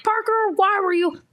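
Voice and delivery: high-pitched accusing mock scold; exasperated parent. Exasperated parent blaming in scene.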